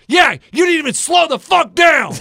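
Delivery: loud, high accusing yell; angry old man. Retold or imitated. Coach retelling the neighbor yelling at him, loud and high. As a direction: snap it at the jogger. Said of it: "He goes".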